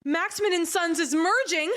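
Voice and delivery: high, drawn-out triumphant announcement; mock an elated accountant revealing big news; very excited. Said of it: "why else would she be so excited".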